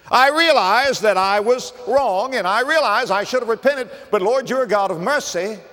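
high, loud pulpit warning; full-force preaching. Real, not acted. Preacher warns congregation against pleading after death, urgent and high. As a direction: preach urgently, acting out the sinner's excuse.

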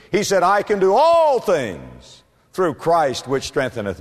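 preacher building on each declaration; impassioned preaching. Real, not acted. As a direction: proclaim it, stretching out the key words. Preaches at full force.

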